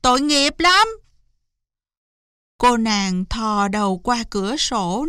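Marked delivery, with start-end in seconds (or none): 0.0-0.9 high, loud door-side shout easing into urgent pleading; urgent calling. Acted. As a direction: call her out, then plead. (gọi lớn, hối hả)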